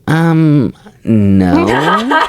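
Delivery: high, drawn-out mock-girly refusal; imitated girl's rejection. Girl turning down his request for a number, exaggerated high refusal. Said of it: "Like that, like that".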